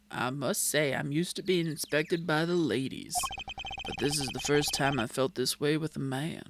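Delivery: low, smooth ladykiller voice; self-assured, teasing low voice; suave flirt. Acted. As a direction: purr it like a vain charmer. Voices Gavin in the game.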